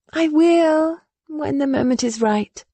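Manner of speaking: put-on earnest and stubborn young woman; young woman, determined. Willa speaks again.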